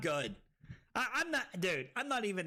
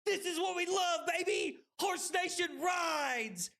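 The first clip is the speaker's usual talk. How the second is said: hyped celebration; 9 semitones higher than usual. Real, not acted. Celebrating a win.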